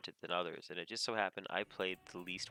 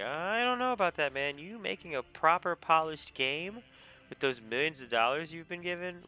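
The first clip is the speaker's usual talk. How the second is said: mock skepticism; 9 semitones higher, 6 dB louder than usual. Player answering game developers, high mock skepticism. Acted sceptical retort to developers.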